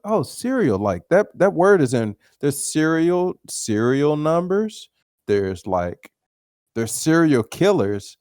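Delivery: put-on a kid thrilled by a new word; excited kid. Young boy musing on cereal in the store, excited.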